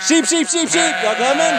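high, loud sing-song livestock call; calling the sheep. Real, not acted. Farmer calling his sheep over to him, loud high sing-song call. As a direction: holler it high across the pasture to bring the flock.

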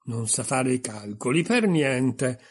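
"per niente" scandito più forte; grande enfasi. A narrator's reading. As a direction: calca con forza su "per niente". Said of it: "con grande enfasi". (enfasi crescente fino a "per niente")

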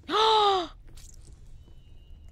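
high, drawn-out gasp; shocked gasp. Real, not acted. Streamer after killing the cockroaches, shocked gasp. Just killed cockroaches in game.

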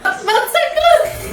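high, slow, laughing begging; drawn-out pleading squeal; laughing plea. Real, not acted. Pleading not to be thrown.

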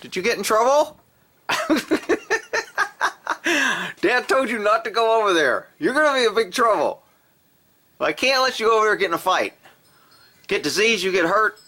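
high, sing-song scolding of the cat; pet-talk scolding. Real, not acted.

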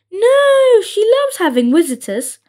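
high-pitched, bright opening "No"; lightly voiced eager little toad; eager baby toad. A narrator's reading. Baby toads reassuring their visitor, eager.

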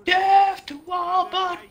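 mock battle cry.

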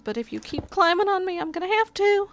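high sing-song threat; high mock warning. Real, not acted. Warning the cat, pitch up.

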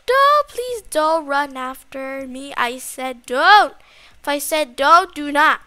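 slow, loud, emphatic pleading; loud protest. Pretend. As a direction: order it off, word by word. Scolds the chasing monster.

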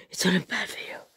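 breathy relieved whisper. Real, not acted. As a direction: whisper it to yourself.

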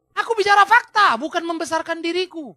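high, emphatic opening burst; acted indignant insistence; impassioned self-defence. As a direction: assert it forcefully as the apostle. Acts Paulus defending himself.

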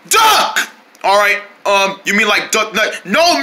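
ordinary talk, nothing marked

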